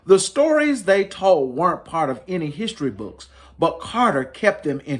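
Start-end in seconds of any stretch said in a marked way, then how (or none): none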